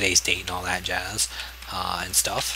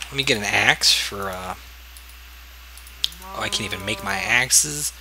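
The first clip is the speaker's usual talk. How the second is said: usual speaking voice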